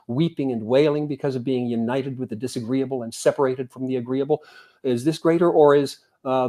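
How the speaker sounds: solemn recital of scripture; scripture reading. Acted. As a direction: read the sutta out with lifted voice. Reads a sutta passage aloud.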